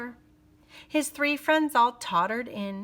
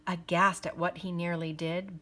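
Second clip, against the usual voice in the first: ordinary delivery, nothing marked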